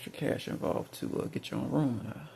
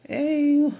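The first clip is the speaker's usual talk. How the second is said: put-on high, bright little Southern accent greeting; playful Southern accent impression of a TV character; Whitley Gilbert; 13 semitones higher, 10 dB louder, much slower than usual. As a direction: chirp it in a Southern belle accent, much higher and louder. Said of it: "Whitley Gilbert talks like a little Southern accent".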